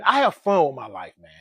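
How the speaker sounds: ordinary talk, nothing marked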